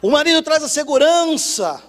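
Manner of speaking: loud, high, rousing sermon cry; rally them to grab the plank and row; preaching at full force. Acted. Preacher rousing the church to hold on to life, loud.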